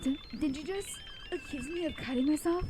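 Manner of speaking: stammer, then snap fast and loud; outraged, firing back quickly; shocked outraged girl. Acted.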